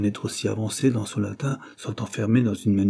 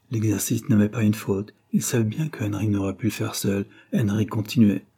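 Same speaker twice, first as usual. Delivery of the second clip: ordinary delivery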